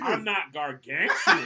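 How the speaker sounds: slow loud laughing protest. Real, not acted. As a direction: laugh while denying it.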